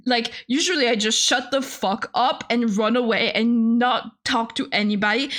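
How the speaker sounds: ordinary talk, nothing marked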